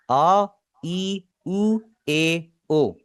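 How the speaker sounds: आ, ई, उ, ए, ओ each drawn out clearly; vowel demo. Shown as a demonstration. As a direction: sound out the five vowels one by one. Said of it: "जरा इन्हें गौर से सुनिएगा". (स्वरों का साफ़ उच्चारण)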